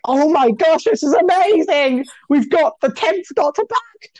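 high-pitched excited fan gushing; freaking out. Retold or imitated. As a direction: re-enact a thrilled freak-out, voice way up high. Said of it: "freaking out and being like".

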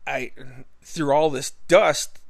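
ordinary talk, nothing marked

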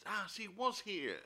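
very high, slow exclaim; high excited discovery. Retold or imitated. Voices characters finding clue.

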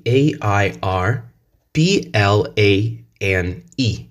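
slow letter spelling; slow teacher spelling. Shown as a demonstration. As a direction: spell A I R P L A N E one by one. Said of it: "In, in American English".

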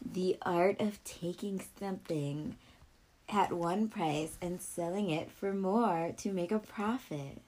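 define it like a solemn scholar; mock dictionary reading. Mock-formal dictionary definition continues.

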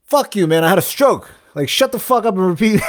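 half-laughing fake rage at the clerk; retelling yelling at the salad guy, amused; retold lashing out. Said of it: "lashing out at this guy".

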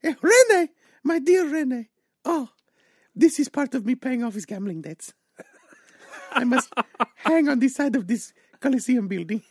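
put-on high, loud Quebec accent; French Canadian accent.

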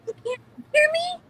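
normal talking voice

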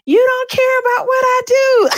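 high, loud frantic yell; high-pitched accusing shout at the boyfriend; crazy person yelling. Retold or imitated. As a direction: shout it like you've lost it. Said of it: "I sounded like a crazy person".

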